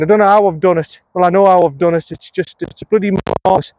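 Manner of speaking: usual speaking voice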